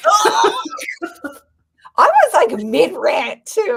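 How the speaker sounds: laughing through the words, high and loud. Real, not acted. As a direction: giggle while complaining about the yawn. Laughing at partner's yawn.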